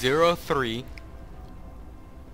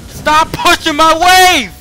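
slow, drawn-out yell, much higher and louder; furious shout; 13 semitones higher, 11 dB louder, slower than usual. Real, not acted. Streamer yelling at Silas to stop pushing his wave, furious shout. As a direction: bellow it at the teammate.

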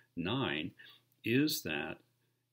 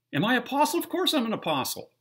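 much higher and louder, ringing rhetorical question; preacher voicing Paul's claim to the congregation, loud; emphatic preaching; 11 dB louder, faster than usual. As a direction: thunder it out as Paul defending himself.

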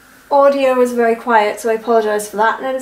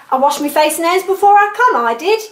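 put-on Cockney accent; 6 semitones higher than usual. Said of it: "Audrey Hepburn has a Cockney accent".

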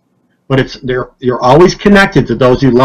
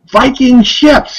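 high, slow, thrilled cry of discovery; excited; 10 semitones higher, much slower than usual. Retold or imitated. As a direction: mimic a client amazed by what he sees. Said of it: "He's getting all excited".

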